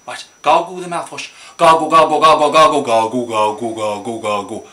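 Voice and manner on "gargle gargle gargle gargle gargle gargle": rhythmic chant starting loud, dropping low and fading; gargling chant. Pretend. Leading the child through mouthwash gargling, chanting.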